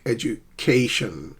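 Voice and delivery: slow "education" with a stressed CA; stress on second-last syllable. Shown as a demonstration. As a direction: draw out the CA in "education". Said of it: "stress the second last syllable".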